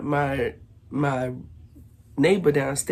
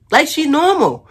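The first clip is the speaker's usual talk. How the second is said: much higher, louder mocking repeat; exaggerated fake normal; 12 semitones higher, 9 dB louder than usual. Retelling neighbor fooling the officers, hammed-up high mockery. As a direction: crank the impression way up and loud. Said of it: "Yes, hello." Like she normal".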